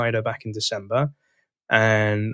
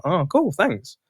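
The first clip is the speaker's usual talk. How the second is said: surprised delight; 6 semitones higher, much slower than usual. Retold or imitated. Reacting to VP praise.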